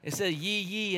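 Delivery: usual speaking voice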